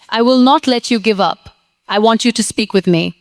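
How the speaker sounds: normal talking voice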